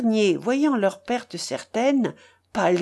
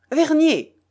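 high, loud cry of a name; calling out; 9 semitones higher, 6 dB louder than usual. A narrator's reading. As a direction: call sharply to a companion. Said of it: "s'écria-t-il". (interpellation forte et aiguë)

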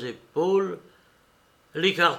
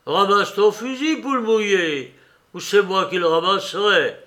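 loud, high, harsh scolding; stern shout; 6 semitones higher, 6 dB louder than usual. A narrator's reading. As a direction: bark it at the coward sternly. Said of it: "cria sévèrement".

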